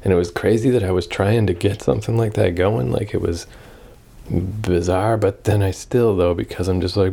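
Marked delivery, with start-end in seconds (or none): none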